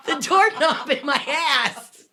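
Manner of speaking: laughing through the words, high and loud; cracking up at her own punchline. Real, not acted. Both laughing at the joke.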